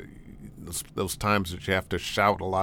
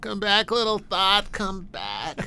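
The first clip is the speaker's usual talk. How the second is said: coaxing; 11 semitones higher, slower than usual. Pretend. Coaxing a lost thought back.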